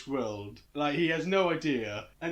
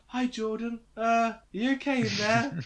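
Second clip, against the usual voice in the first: lightly acted, higher-pitched tentative call; mock coaxing parent; 5 semitones higher than usual. Talking to a kid after an argument, mock coaxing. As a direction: check gingerly on a sulking kid.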